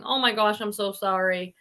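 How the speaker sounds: ordinary talk, nothing marked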